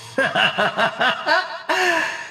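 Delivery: high, rolling villain cackle; wicked laugh. Acted. Villain planning to make friends scream, wicked cackle. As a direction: cackle at the cruel idea.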